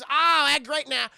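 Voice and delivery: loud, high, slow drawl; hammed-up delighted country voice; cowboy stranger. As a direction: crow it like a greedy hillbilly. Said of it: "this guy in a cowboy hat".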